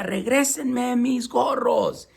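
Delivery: high, slow demanding; mock furious order; angry peddler. A narrator's reading. Vendedor enojado gritando a los monos. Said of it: "mientras les decía".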